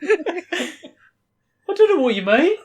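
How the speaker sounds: high, slow Brummie with laughter; Brummie accent. Acted. Dave answers the teasing players, high slow Brummie accent. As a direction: play innocent in drawn-out high Brummie. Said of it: "This is the weirdest accent".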